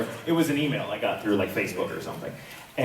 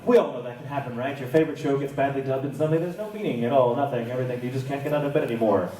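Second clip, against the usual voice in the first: usual speaking voice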